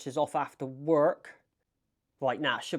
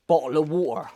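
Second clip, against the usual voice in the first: "Bottle of water" in a strong Bristolian accent; 5 dB louder than usual. Shown as a demonstration. Bristolian vlogger showing how Brits say it, Bristolian accent. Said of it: "the way we say bottle of water".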